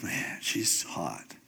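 low, slow, soft and dreamy; lovestruck murmur. Retold or imitated. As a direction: sigh it out like a smitten young man. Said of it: "And he's like".

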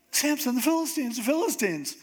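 high-pitched urgent wake-up cry; mock alarm. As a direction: sound the alarm to a sleeper, pitch jumped up. Delilah acts out waking Samson in alarm.

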